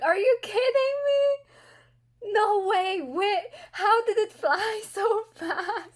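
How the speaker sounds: high, slow, half-crying protest; distressed disbelief. Real, not acted. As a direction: protest in mock despair. Upset the book is ending.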